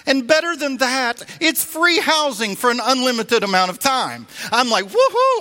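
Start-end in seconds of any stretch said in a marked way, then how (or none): 0.1-4.3 higher, thrilled inner voice; gushing with disbelief at his luck; excited inner cheering. Retold or imitated. Pastor retelling his brain cheering at the offer, higher and thrilled. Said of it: "My brain is going". 4.9-5.4 high, loud whoop; cheering woohoo. Retold or imitated. Pastor whooping at the free housing offer, high and loud. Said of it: "I'm cheering".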